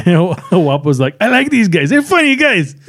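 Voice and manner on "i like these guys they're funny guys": high-pitched amused movie bandit; hammed-up El Guapo quote; bandit chief. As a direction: play the villain tickled by his guests.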